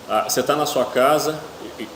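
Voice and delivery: ordinary talk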